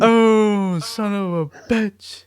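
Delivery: loud, high, drawn-out exclamation; mock outcry. Paulie reacting to Vito's secret, mock outcry. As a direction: cry it out slowly, hammed-up and high.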